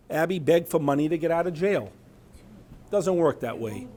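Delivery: ordinary talk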